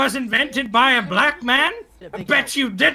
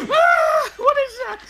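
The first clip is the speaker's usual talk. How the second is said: high, startled shout; shocked cry. Real, not acted. Shocked at the gory scene.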